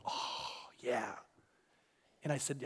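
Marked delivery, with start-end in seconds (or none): 0.1-1.2 put-on soft, breathy childlike oh yeah; lightly imitated toddler; toddler's reply. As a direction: whisper it like a sleepy kid. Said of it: "Rich is all like".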